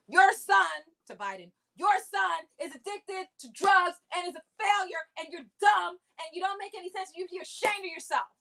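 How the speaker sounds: hammed-up Trump going for the jugular; mock Trump attack. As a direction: parody of a bully's taunt. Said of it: "Trump tonight was just like".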